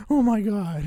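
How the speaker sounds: high-pitched imitation of an appalled woman; mock dismay. Woman to the man who grabs her as she leaves, mock dismay. Voices woman grabbed by awkward Robert.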